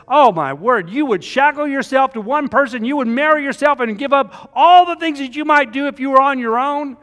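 high and loud, an appalled "oh my word" voice; mocking the shocked onlooker, pitched high and loud; mock horrified outsider. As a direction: play the scandalised skeptic, hammed up. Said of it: "some people see that as".

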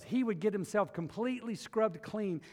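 usual speaking voice

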